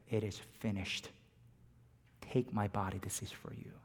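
soft, low, fading to a whisper; hushed words. Acted. Jesus on the cross, speaking in a hushed whisper. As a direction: let the words fall away to a hush.

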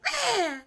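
high-pitched mock tantrum squawk; upset child's cry. Upset son before running to his room, high mock squawk. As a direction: imitate a kid's frustrated bleat, way up high. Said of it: "he was really upset".

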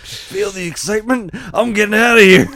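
high, loud hammed-up ad voice; overselling the getaway; continuing the joke car ad, hammed-up high announcer; mock ad. Joking ad continuation.

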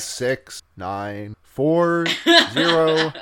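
very slow number-station readout, each digit held apart; slow, deliberate mock recorded voice; goofy number station. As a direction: drone out the digits like an eerie automated broadcast. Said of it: "a goofy number station".

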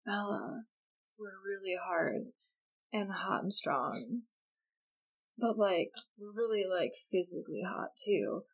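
mock sultry murmur. Jacob teasing Bella about being hot, hushed mock seduction. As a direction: purr it back like a cheesy heartthrob.

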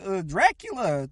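high, singsong, bumbling wizard; hammed-up muddled wizard; random wizard. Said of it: "a random wizard shows up and says".

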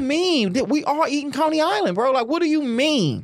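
high, disbelieving rant; incredulous. Real, not acted. Mocking Detroit braggers, incredulous.

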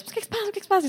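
high-pitched imitation of puzzled colleagues; high, mock-concerned questioning. Colleagues' reaction in acted scene.

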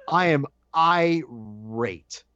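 very slow, deliberate "I am irate"; emphatic irate. Retold or imitated. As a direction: stretch out "irate" with weighty pauses. Emphatic restatement of his anger.

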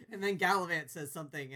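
ordinary talk, nothing marked

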